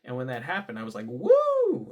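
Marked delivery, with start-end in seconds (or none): 1.1-1.9 high, loud drawn-out "woo"; excited whoop. Retold or imitated. Worker cheering a high five with a coworker, excited whoop. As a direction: let out a big, ringing cheer. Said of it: "me being excited, going".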